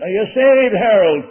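loud, high demand, imitating an Irish preacher; mock rough Irish preacher's shout; rough old Irishman. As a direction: fire the question across the whole room. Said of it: "In that rough old Irish voice".

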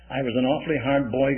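ordinary delivery

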